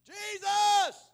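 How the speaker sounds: desperate full-voice shout, very high; hollering out. Retold or imitated. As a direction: cry out for Jesus with everything. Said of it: "They'd holler louder".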